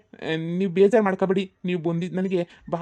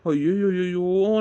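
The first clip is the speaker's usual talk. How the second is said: mock wail.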